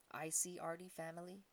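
a small monkey asking shyly; hopeful monkey voice. A narrator's reading.

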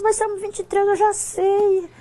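high-pitched retold objection; mock protest. Replays her protest to God.

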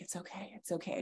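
very soft, gentle hush; soft reassurance. Real, not acted. Daughter hugging her mom, soothing her very softly. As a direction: soothe her in your arms.

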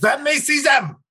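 barked high German officer shout; bad German accent. Co-host mocking German officials, shouting in a German accent. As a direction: yell it like a German guard demanding papers. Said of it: "Bad German accent".

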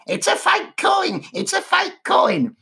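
high parrot voice. Acted. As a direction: squawk it like a talking parrot.

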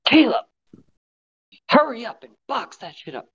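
high, mock yell at a worker; imitating a boss's yell. My boss yelling at me at work. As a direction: bark it like a pushy boss. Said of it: "yelling at me at work".

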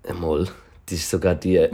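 ordinary talk

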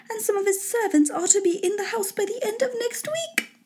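high-pitched lady full of news; sustained high wife character voice; gossiping wife. Acted.

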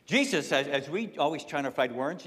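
ordinary delivery

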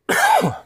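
sharp cough; loud single cough; coughing fit. Real, not acted. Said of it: "Sorry, I'm coughing still today".

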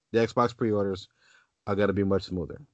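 ordinary talk

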